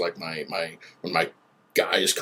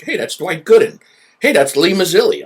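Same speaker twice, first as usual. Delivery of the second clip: excited; 9 semitones higher, 7 dB louder than usual. Retold or imitated. Retold excited recognizing ex-Mets.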